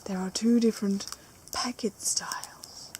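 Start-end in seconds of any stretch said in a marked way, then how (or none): 1.5-2.9 whispered. Acted. Hushed dramatic aside.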